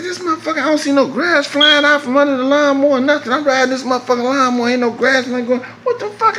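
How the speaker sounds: high, rising exasperated disbelief. Retold or imitated. Noticing the mower cuts no grass, high and incredulous. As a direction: pitch it up like you can't believe it. Discovering mower still broken.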